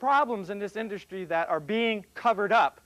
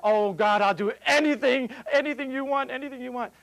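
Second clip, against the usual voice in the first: desperate pleading; 8 semitones higher, 4 dB louder than usual. Retold or imitated. Guy begs God desperately.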